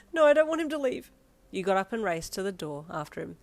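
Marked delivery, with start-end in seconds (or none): 0.1-1.1 higher, louder desperate cry; panicked. A narrator's reading. Panicked plea.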